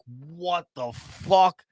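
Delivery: very slow, drawn-out disbelief; baffled waking groan. Retold or imitated. Waking hungover after the fall, slow bewildered drawl.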